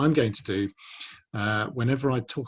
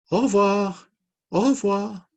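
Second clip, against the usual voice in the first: high, loud puppet voices; sing-out puppet goodbye, high-pitched; puppet farewells; 11 semitones higher, 6 dB louder, slower than usual. Acted. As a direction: call goodbye as squeaky little puppets. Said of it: "Matisse and Inez".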